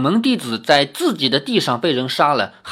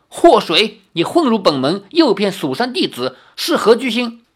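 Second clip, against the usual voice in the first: raised, higher-pitched accusing voice; indignant master demanding an answer; stern denunciation; 6 semitones higher, 3 dB louder than usual. A narrator's reading. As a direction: denounce an intruder with force. Master denounces the woman before disciples.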